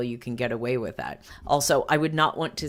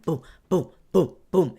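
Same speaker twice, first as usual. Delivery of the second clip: mock thuds; 3 semitones higher, 5 dB louder than usual. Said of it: "It sounds like people are marching".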